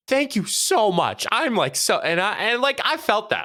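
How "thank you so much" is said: gushing thanks. Retold or imitated. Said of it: "She goes".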